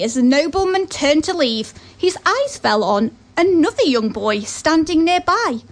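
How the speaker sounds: ordinary delivery, nothing marked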